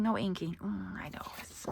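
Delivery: soft unvoiced whisper to herself; hushed aside to herself. Real, not acted. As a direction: breathe it, barely audible.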